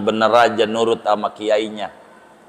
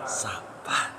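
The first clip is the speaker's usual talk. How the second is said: whisper; 5 semitones lower, 16 dB quieter than usual. Shown as a demonstration.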